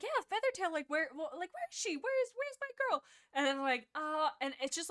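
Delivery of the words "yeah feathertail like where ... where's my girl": high-pitched, anxious mock questioning; awkward searching. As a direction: imitate him haltingly asking, voice climbing very high. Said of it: "awkwardly, he's like".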